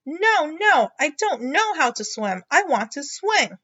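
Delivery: Froggy refusing his mother; Froggy's voice. Acted. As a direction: refuse like a scared little frog.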